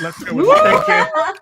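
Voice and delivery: squealing high through laughter; cracking up mid-sentence; laughing. Real, not acted. Laughing after swearing on air.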